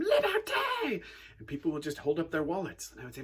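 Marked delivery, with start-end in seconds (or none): 0.0-1.0 much higher, drawn-out laughing scream; mob scream. Retold or imitated. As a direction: scream it slowly like a giddy mob. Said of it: "sixty people would all scream".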